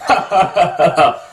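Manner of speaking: imitating a grown man's belly laugh; mock a big hearty adult laugh; a rolling ha ha ha from the belly; deep laugh. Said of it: "It's a".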